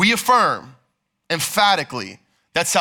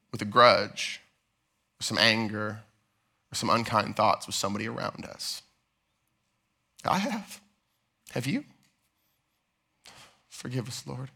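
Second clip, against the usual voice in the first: much softer and lower, almost hushed; soft confession; 7 semitones lower, 9 dB quieter than usual. Real, not acted. Pastor quietly admitting his own grudges to the church. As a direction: confess it gently, then pray quietly.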